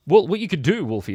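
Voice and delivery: ordinary talk, nothing marked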